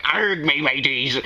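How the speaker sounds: slow growly Irish pirate voice; pirate Irish accent. Retold or imitated. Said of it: "like, a pirate, pirate Irish accent".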